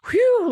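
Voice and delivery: high, loud sigh of relief; retelling the crew's relief as Picard joins; relieved whew. Retold or imitated. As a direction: let out a big relieved whew. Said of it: "Beverly and Troi kinda go".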